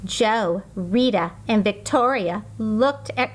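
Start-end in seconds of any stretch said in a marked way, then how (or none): none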